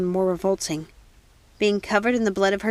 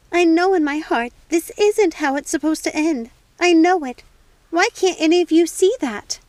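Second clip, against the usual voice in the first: high, loud, urgent conviction; desperate insistence; 8 semitones higher, 6 dB louder than usual. Acted. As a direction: make them see it.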